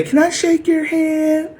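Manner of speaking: high, slow mock plea; mock pleading. Mocking the enemy's pleading handshake request, high and simpering.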